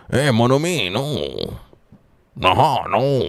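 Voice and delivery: put-on Cajun twang with swooping pitch; mock Cajun accent. Streamer fooling around with an accent, mock Cajun. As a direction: play it up as a hammed-up Cajun. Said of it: "get a Kajuna accent".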